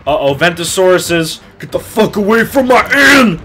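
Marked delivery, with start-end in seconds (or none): 1.6-3.4 loud high yell at the creature; angry shout. Real, not acted. Gamer yelling at a monster near his inn, furious shout. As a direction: roar it at the monster to get out.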